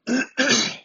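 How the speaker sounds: sharp throat clear. Real, not acted. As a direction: clear the throat with a quick cough. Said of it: "Sorry, I'm coughing a little bit".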